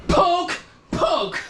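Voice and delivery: very high, squeaky exclamation; playful poking cry. Acted. Player acts out playful bops.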